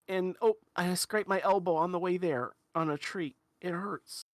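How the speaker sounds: put-on high little-kid voice, halting, sinking toward the end; small hurt child. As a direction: confess your scrapes like a sorry little kid. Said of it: "then she, she looks down".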